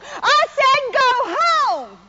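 loud, high, slow shout; woman yelling at her dog to go home. Retold or imitated. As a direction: yell it at the stubborn dog. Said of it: "she yells at the dog".

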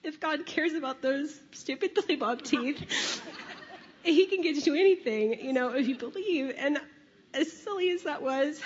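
a voice catching between laugh and tears; moved, half-laughing, close to crying; laughing near tears. Real, not acted. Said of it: "sorry, I'm gonna cry".

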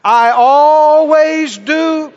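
very slow, stretched-out proclamation; out-loud declaration. Shown as a demonstration. Preacher leading the congregation to say it, drawn-out declaration. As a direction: hold "always" long and strong. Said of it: "say it out loud".

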